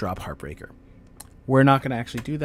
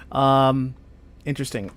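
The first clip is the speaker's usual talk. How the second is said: ordinary delivery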